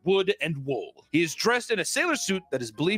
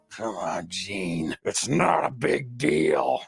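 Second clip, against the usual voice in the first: low doll voice; 7 semitones lower, much slower than usual. Retold or imitated. Doll persuading the boy, low slow eerie. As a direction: coax it like a sinister doll. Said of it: "doll answering the boy".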